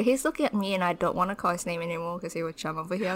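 ordinary delivery

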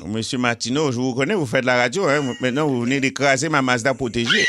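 put-on improvised Haitian-accented crash victim. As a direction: accuse the driver in a playful Haitian accent. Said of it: "jaser avec un accent haïtien ou en créole". (accent haïtien, accidenté indigné pour rire)

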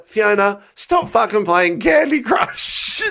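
high, drawn-out exasperated scolding; exasperated telling-off. Retold or imitated. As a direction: scold a friend who won't listen, stretching the words. Telling off friend at premiere.

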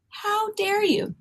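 high, slow, softened affront; mock indignation. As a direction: draw it out in hushed, high-pitched outrage. Retold offence at insulting letter.

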